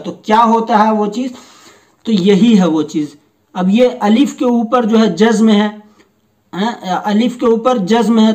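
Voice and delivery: usual speaking voice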